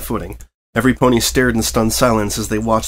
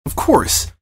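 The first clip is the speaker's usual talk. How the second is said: ordinary delivery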